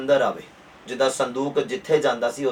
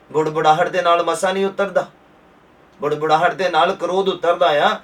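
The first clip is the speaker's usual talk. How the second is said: rising, louder pulpit drive; fervent preaching; 3 semitones higher, 4 dB louder than usual. Acted. Preacher urges congregation to worship, rising fervent louder preaching. Preaching builds to full force.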